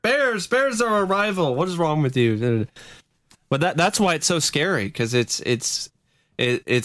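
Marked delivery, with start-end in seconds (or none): 0.0-2.7 high, mock-indignant protest; indignant Wisconsinite. Wisconsinite objecting to a rival team, mock indignant. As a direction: protest it, pitched well up. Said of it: "Wisconsin would be like".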